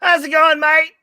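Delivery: high, loud put-on Australian accent; hammed-up Australian accent; fake Australian accent. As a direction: greet them like a cartoon Aussie. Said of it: "You sound funny".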